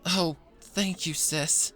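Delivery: overjoyed little sister thanking; grateful happy burst; happy thanks. A narrator's reading. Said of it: "Pinky said happily".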